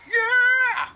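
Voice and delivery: high triumphant yell; victory cheer. Real, not acted. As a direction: cheer it out loud.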